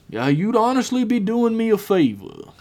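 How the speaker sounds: high, offhand villain voice; shrugging indifference. Acted. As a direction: toss it off as if unbothered. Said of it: "Billiam shrugged his shoulders".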